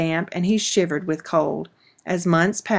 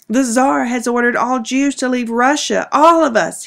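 louder, higher anguished cry; sobbing; 6 semitones higher, 5 dB louder than usual. Acted. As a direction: break down over the news. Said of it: "he sobbed".

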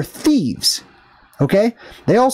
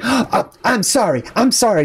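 higher-pitched imitation of affronted woman; mock indignation; 5 semitones higher than usual. Greeter reacting to customer walking away, mock indignation. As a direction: mimic an offended store greeter after a gasp.

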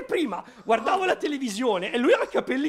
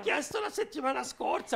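usual speaking voice

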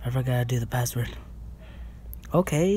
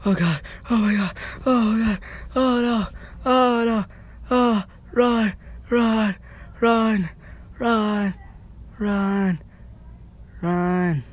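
panicked shouting; 9 semitones higher than usual. Real, not acted. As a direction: panic and yell to flee. Panicking while being chased.

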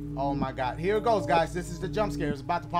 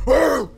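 loud mock monster roar; 5 semitones higher, 15 dB louder than usual. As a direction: roar like a jump scare. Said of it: "He gonna turn around like".

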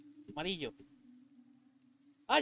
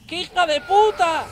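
loud high cursing yell; panicked shout; 9 semitones higher, 10 dB louder than usual. Real, not acted. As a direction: yell in panic as she closes in. Witch coming for him.

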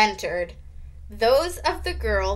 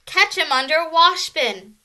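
raised, urgent yell; 6 semitones higher, 6 dB louder than usual. A narrator's reading. Girl directing the mouse hunt, yelling. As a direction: call out the plan loudly. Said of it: "someone yelled".

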